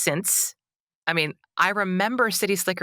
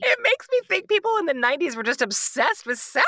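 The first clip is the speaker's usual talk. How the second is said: incredulous; 12 semitones higher than usual. Real, not acted. Incredulous high pitch.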